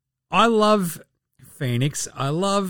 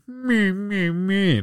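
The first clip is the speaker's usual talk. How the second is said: higher bleating mock cry; mock whimper; 6 semitones higher, much slower than usual. Host mocking crying players with bleating whines. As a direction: bleat like a whining baby.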